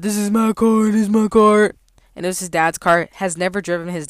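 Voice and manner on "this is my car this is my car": higher, mock-boastful repeat of the son's claim. Mimicking the customer's son bragging about the car, mocking. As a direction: parrot him like a bragging kid.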